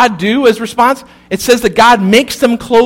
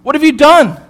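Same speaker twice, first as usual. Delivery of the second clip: a hint of God calling higher through the garden; 6 semitones higher, 3 dB louder than usual. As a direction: call out searching, lightly voiced. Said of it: "and he says".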